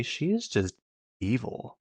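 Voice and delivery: ordinary talk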